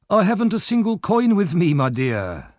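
put-on high, soothing old-man voice; smooth him over, pitched high.

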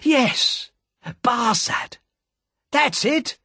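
high triumphant cry of Barsad; excited cry. Acted. Jerry recognizes the spy, high excited cry of Barsad. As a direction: shout Barsad as it all clicks. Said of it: "cried Jerry".

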